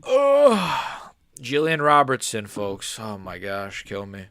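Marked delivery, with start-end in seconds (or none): 0.1-1.1 high, loud drawn-out groan; loud exasperated groan. Real, not acted. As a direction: groan out in dismay, high then falling. Groans at the coming fight.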